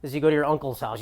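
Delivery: ordinary talk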